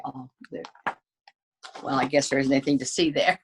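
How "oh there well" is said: ordinary delivery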